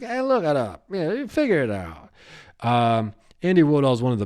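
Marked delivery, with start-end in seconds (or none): 0.0-2.1 dismissive brush-off. Retold or imitated. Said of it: "just be like".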